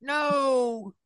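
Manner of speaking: high, drawn-out hammed-up denial; mock disbelief. Townsperson refuses to believe Bernie did it, mock disbelief. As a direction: stretch it out high, feigning shock.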